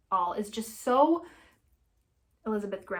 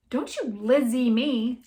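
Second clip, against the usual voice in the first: slow, louder, biting each word; angry hiss; 3 semitones higher, 8 dB louder, slower than usual. Acted. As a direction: spit it back at him, furious. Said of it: "she hissed".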